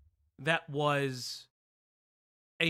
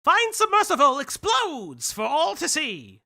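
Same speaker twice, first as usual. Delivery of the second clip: put-on loud, higher old-time Mid-Atlantic accent announcer; 6 semitones higher, 8 dB louder, slower than usual. Shown as a demonstration. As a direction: boom it out like a 1940s newsreel with a Mid-Atlantic accent. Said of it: "Atlantic accent is like".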